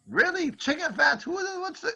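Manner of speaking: very high, baffled questioning; high-pitched, bewildered protest; acted incredulity. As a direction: play disbelief at absurd news, voice shooting up. Diner hears odd currency, acted.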